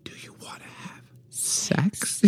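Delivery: slow, breathy whisper; hushed, drawn-out seduction; seductive whisper. Shown as a demonstration. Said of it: "just change the tone a little".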